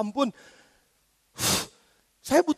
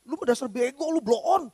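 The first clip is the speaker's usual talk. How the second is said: exasperated father scolding, voice climbing high; a fed-up dad rising higher as he nags his child; scolding parent; 6 semitones higher than usual. Retold or imitated. Father scolding his child for disobeying, rising to a high exasperated pitch. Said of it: "Tapi sama anak lu gimana?".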